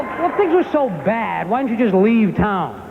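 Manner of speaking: exasperated son, high and drawn out; slow, high-pitched mock-angry challenge to Dad; mad kid. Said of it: "And I'd get mad and go".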